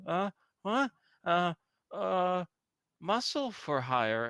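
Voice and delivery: a nervous stranger caught off guard; lightly voiced startled elf; startled passerby.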